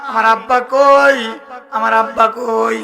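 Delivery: slow, drawn-out mock wail; preacher's stylised lament, vowels stretched long; retold tearful wailing. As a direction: imitate grieving daughters crying out for their father. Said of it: "মেয়েরা কানতে কানতে চলে আসছে".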